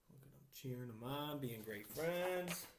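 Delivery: ordinary delivery, nothing marked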